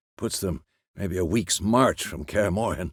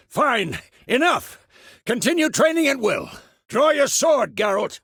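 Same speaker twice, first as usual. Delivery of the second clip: high, raised instructor's call; shouted training commands; 13 semitones higher than usual. Acted. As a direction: call out drill orders across a training yard.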